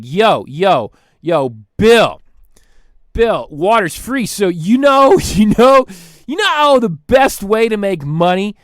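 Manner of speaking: loud, hyped "yo" with rising questions; hyped-up pitch. Retold or imitated. Freddie pitching Bill on selling water, loud and hyped. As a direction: sell the idea like a hustler, building. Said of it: "Freddie's like".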